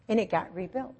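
ordinary talk

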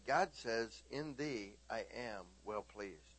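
quiet, gentle retold blessing; soft telling. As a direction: say it softly, almost to yourself.

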